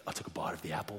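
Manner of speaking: near-whispered, dramatic confession; hushed retake. Real, not acted. Storyteller biting the forbidden apple, hushed and near-whispered. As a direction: drop right down to a hush. Restarts the line for dramatic effect.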